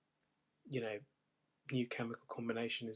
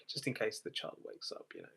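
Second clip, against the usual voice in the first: hushed whisper; 2 semitones lower, 7 dB quieter than usual. Acted. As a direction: whisper so the child won't wake. Hushed so child stays asleep.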